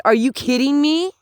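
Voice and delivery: high, indignant outburst that settles slightly; outraged disbelief. Acted. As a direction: fire back at a bragging bystander, appalled. Outraged reply to bragging neighbor.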